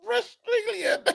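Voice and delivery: very high laughing squeal; cracking up. Real, not acted. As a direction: squeeze the word out through laughter. Host laughing at the fake accent.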